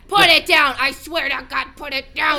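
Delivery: loud barking order; hammed-up cop yelling at a suspect; mock command shout. Air marshal yelling at the husband to drop it, loud.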